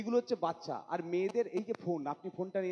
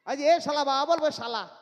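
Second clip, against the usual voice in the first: losing patience, louder and higher on the command; exasperated yell; 4 semitones higher, 6 dB louder, slower than usual. Retold or imitated. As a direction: snap at the toddler to say baba. Father losing patience with toddler. (ধৈর্য হারিয়ে চেঁচিয়ে ওঠা বাবা)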